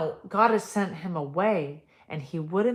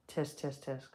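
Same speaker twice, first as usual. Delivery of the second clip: mock tutting; 4 semitones lower, 9 dB quieter than usual. Real, not acted. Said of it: "mock disapproval".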